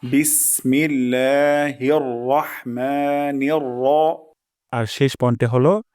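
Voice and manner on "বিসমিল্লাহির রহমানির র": slow বিসমিল্লাহির রহমানির র with a short র; careful tajweed demo; slow recitation. Shown as a demonstration. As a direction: recite it slowly, keep র short. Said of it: "কখনো রা হবে না".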